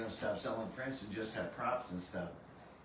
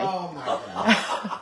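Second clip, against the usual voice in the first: surprised laugh; 6 semitones higher, 11 dB louder, much slower than usual. Real, not acted. Shocked reaction to late book.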